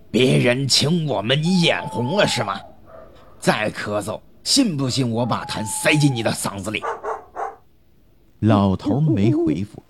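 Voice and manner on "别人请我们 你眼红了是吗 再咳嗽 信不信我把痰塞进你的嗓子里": narrator's raised, scolding character voice; mildly angry scolding. A narrator's reading. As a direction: scold with a touch of angry threat. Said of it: "又气又大， 骂道". (略带怒气的骂声)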